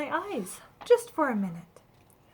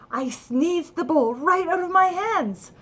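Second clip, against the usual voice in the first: usual speaking voice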